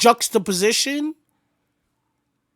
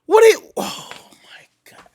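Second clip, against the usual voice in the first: stunned whisper; 9 semitones higher, 3 dB louder than usual. Real, not acted. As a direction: whisper it in disbelief. Stunned reaction to the verse.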